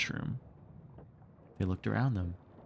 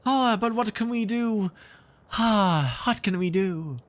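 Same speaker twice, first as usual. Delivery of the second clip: put-on panicked boys; 10 semitones higher, 3 dB louder than usual. A narrator's reading.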